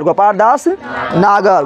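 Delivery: very slow, every syllable stretched; very slow dictation. Shown as a demonstration. Teacher repeating the answer for students, very slowly. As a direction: drag the name out so they write it down. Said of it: "अरे बोल जल्दी".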